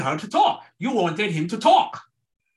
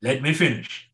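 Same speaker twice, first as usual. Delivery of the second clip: quietly imitating a calm wife, softer and lower; a gentle, patient hold-on; 4 semitones lower, 5 dB quieter than usual. Wife calmly asks husband Richard to let her finish, softly imitated. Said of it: "she would very calmly say".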